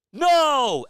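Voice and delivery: very high, very loud cry; loud shout. Real, not acted. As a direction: yell as the shot misses. Missed three at buzzer.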